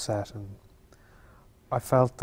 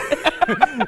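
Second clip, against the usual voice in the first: a sudden high laugh, louder; high burst of laughter; 6 dB louder than usual. Real, not acted. Laughing at a joke about having no friends on stage. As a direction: laugh out loud, high and bright.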